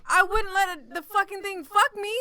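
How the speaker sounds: mock outraged yell. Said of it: "I'm like".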